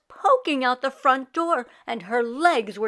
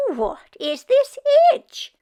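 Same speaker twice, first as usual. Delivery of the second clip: put-on high, loud puzzled old woman; 13 semitones higher, 7 dB louder than usual. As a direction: squeak it out, baffled by the itch. Meshka puzzled by her tongue.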